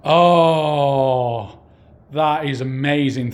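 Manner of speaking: loud, thrilled "oh" of surprise; amazed gasp. Real, not acted. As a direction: react as if a spirit just answered. Sudden response during ghost hunt.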